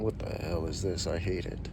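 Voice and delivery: put-on low, soft grumbling old viewer; a hint of a cranky older person; grumpy old person. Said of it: "older people going".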